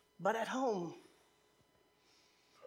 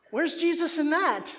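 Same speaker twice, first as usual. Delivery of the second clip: high, loud, drawn-out challenge; mock reproach; 6 semitones higher, 7 dB louder than usual. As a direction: retell a son scolding mom, slow and raised. Son reproaches mom, retold.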